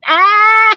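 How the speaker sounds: sudden high squeal of delight; bright high yelp. Real, not acted.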